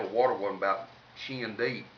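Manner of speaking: ordinary talk, nothing marked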